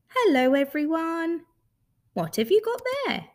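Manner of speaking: high, cheery creature greeting; friendly furry creature. Acted. New creature greets the toys, high friendly voice. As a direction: greet them all in a high voice.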